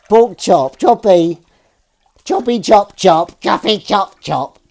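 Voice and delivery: high, loud, drawn-out cat-name chant; playful pet call. Pretend. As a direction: call the cat with silly repetition. Cat-calling, playing with the name.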